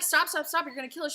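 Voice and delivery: mock panicked yell at her mom on the freeway; retold panicked screaming. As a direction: scream at her to stop in the car. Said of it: "I'm screaming at her".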